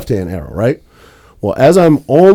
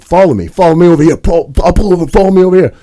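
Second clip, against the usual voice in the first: imitating angry driver; 6 semitones higher, 3 dB louder than usual. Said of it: "And he's like".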